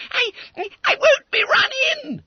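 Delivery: very high, loud crying howl. Acted. Boy afraid of the police, high loud crying howl. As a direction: howl in fear of arrest. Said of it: "howled Bunter".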